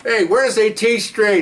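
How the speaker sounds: put-on slow, high-pitched drunken call; high, dragged-out mock drunk; drunk act. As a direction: play an obnoxious drunk asking directions. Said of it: "he starts drooling like he's drunk".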